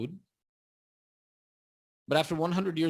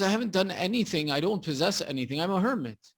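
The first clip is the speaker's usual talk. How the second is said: retold protest, pitched up; pleading protest; 6 semitones higher, 3 dB louder than usual. Hermit tells traveller he owns nothing, pleading protest. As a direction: plead empty-handed, lightly acted.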